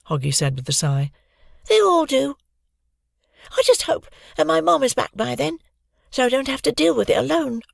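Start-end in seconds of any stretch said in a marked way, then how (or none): 1.7-7.6 put-on high, bright young girl. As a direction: voice Hoggy high and light. Narrator voices Hoggy.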